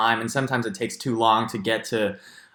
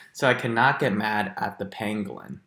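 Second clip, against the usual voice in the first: ordinary talk, nothing marked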